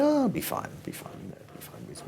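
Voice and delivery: airy high oh then fading to a whisper; breezy dismissal. Retold or imitated. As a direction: wave the worry away lightly. Producer brushing off worries.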